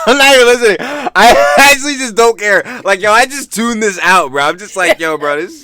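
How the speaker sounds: high, loud exasperation after a laugh; loudly protest, pitched high; exasperated outburst. Real, not acted. Laughing outburst, refusing to listen.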